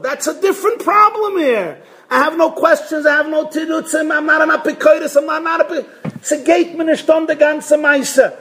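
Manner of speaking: high-pitched, insistent protest; protesting indifference. Acted. Child who showed up for dinner, protesting indifference. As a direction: rattle off excuses high and exasperated.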